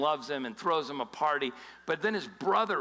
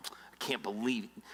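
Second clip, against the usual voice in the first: whispered, muttered in disbelief; sulky whisper; 6 semitones lower, 6 dB quieter than usual. Retold or imitated. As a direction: hiss it under your breath like a sulking brother. Brother sulking off to the side.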